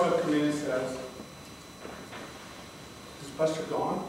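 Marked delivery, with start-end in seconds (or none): 3.2-4.0 subdued question. Retold or imitated. Crying wife asks about cat.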